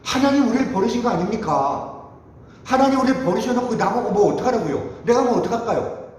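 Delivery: imitated aggrieved outcry; resentful lament. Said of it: "이런 불평과 원망을 하는 거예요".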